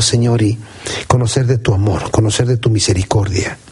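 low, measured praying voice with pauses; prayer. Real, not acted. Pastor thanking God at the close, praying.

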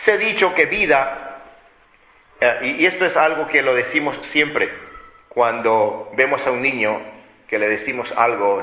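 ordinary delivery

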